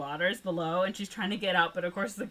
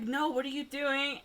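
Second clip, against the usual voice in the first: high-pitched retold protest; hammed-up disbelief; mock exasperation; 7 semitones higher than usual. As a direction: imitate an alarmed objection, voice up high. Retold Malcolm protesting to the couple.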